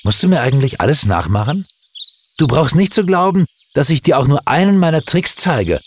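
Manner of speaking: high, fast jealous outburst; jealous boy snapping at his friend; angry. A narrator's reading. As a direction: snap at him in envy. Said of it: "sagte er böse".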